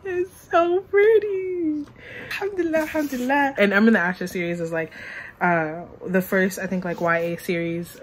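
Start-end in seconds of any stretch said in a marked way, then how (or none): 0.0-1.8 high, slow, tearful and breaking; crying from joy over the book; much higher, slow, sobbing with happiness; real happy crying. Real, not acted. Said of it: "Let me start crying". 2.3-3.5 tearful thanks. Real, not acted. Grateful tears over the book.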